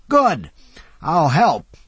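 put-on high, loud grand old man; a lightly theatrical old philosopher lecturing; old orator. A narrator's reading. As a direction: hold forth with gleeful doom. Old Jay's voice.

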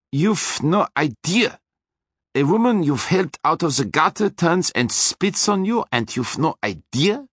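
menacing Austrian driver, high; threatening Austrian voice; Austrian accent. A narrator's reading. Hector scolding Keith.

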